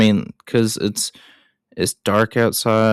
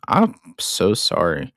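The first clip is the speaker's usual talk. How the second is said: ordinary talk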